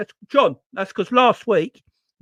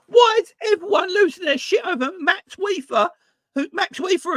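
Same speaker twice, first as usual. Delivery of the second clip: normal talking voice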